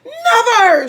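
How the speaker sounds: high, loud anguished cry. A narrator's reading. Wife screaming her denial at husband. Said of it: "she cried".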